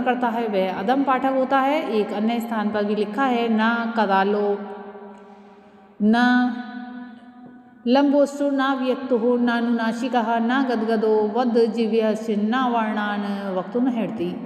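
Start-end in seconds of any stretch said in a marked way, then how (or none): 3.5-14.4 slow, measured chanting of a Sanskrit shloka; Sanskrit verse recitation. Acted. Teacher reciting Panini's verse to students, slow and measured. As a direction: recite each word slowly, like scripture.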